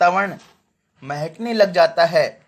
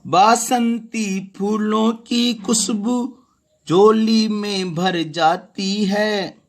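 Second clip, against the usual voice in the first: slow, higher, lilting verse reading; poem recitation; 3 semitones higher, slower than usual. Shown as a demonstration. Teacher reciting the spring verse to students, slow and lilting. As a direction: savour each word of the poem.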